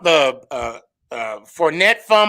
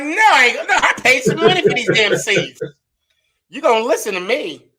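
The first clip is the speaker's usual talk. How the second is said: high, laughing mock outrage; mock indignant protest; 7 semitones higher than usual. Fan telling off the line judge over seat money, mock indignant. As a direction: play up a fan's protest through laughter.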